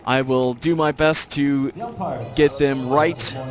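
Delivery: usual speaking voice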